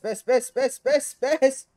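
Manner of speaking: high, loud repeated bursts; alarmed shouting. Real, not acted. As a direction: fire it off to halt a disaster. Trying to halt the character.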